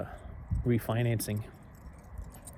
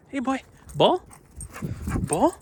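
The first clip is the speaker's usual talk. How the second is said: rising, louder excited calls; talking to a dog; 13 semitones higher, 5 dB louder than usual. Real, not acted. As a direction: hype the dog up with ball. Calling dog with ball.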